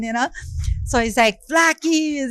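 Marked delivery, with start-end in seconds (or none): 1.5-2.3 high-pitched excited greeting; retold thrilled name call, pitched up; all excited. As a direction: imitate him greeting a favorite artist with excitement. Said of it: "all excited".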